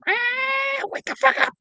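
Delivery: very high, drawn-out bird screech; screaming cockatiel. Retold or imitated. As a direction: imitate an insistent squawking pet bird. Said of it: "he'd get more".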